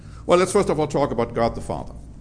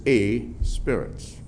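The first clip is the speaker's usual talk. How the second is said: "a" slowed and weighted before spirit, slower pace; stress on a; slower than usual. Shown as a demonstration. Preacher stressing "a" to the congregation. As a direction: draw out "a" in "a spirit". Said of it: "the emphasis is".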